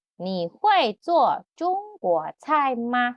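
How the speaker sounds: "你会做中国菜吗" slowly and clearly; slow repeat. Shown as a demonstration. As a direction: repeat for students.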